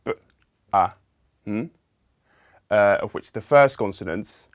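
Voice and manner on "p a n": "p, a, n" as three separate sounds, pauses between; isolated phonemes. Shown as a demonstration. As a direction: sound out "p, a, n" one phoneme at a time. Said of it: "we have three phonemes".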